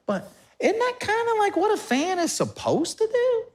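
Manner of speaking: high whiny what-else-would-I-do; incredulous fan. Retold or imitated. Pastor voicing a loyal fan, high and baffled.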